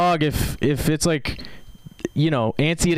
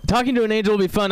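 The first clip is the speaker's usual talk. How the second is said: ordinary delivery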